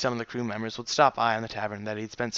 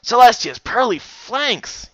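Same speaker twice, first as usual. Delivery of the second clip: loud, high, slow oath; cursing; 10 semitones higher, 8 dB louder, slower than usual. Acted. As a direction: swear it out in alarm. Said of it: "he cursed".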